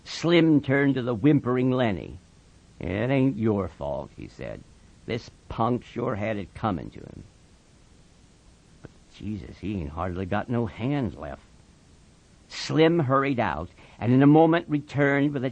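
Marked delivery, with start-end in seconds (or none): 2.8-4.1 low, soft comfort; calm him quietly; hushed, deep reassurance; reassuring Slim. Acted. 5.1-11.4 very soft, low voice; hushed, deep-pitched musing; sober Slim. Acted.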